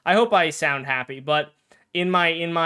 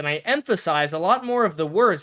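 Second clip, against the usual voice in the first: higher, louder, emphatic YouTuber delivery; 4 semitones higher, 3 dB louder than usual. Shown as a demonstration. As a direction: punch the words like a hyped Shorts host. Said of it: "I talk a lot more like this".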